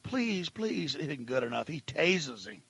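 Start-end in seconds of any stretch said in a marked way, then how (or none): 0.0-0.9 soft imitation of a man pleading; small, hushed mock plea; retold begging. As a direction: beg quietly, in retelling. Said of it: "He's begging".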